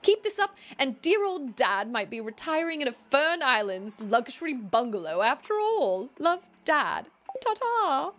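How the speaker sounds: put-on high, theatrical British accent. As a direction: read the letter aloud in a put-on British voice. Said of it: "read this in a British accent".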